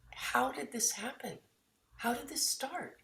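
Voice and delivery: soft, high puzzled questioning; hushed, high musing; wondering aloud. Acted. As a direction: lift the pitch and drop the volume, as if asking yourself. Voicing a question we ask ourselves.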